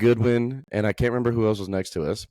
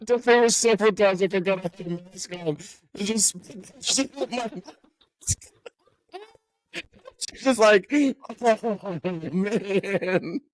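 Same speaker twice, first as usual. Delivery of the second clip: laughing while talking; 9 semitones higher, slower than usual. Real, not acted. Cracking up at own mask story.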